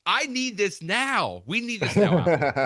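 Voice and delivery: high, drawn-out mock plea; mock demanding. Podcast host pleading with Apple for the feature, high mock demand.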